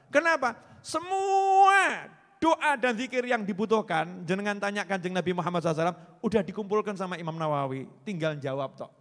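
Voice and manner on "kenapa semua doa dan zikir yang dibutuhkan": high, loud, slow preaching; rising emphatic call. Acted. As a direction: stretch it out, preaching at full force. (khotbah lantang dan lambat)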